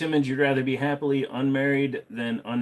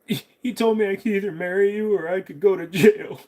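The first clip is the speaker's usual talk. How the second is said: put-on higher, louder aggrieved old man; old man's lament; 10 semitones higher, 6 dB louder than usual. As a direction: complain as the sad husband.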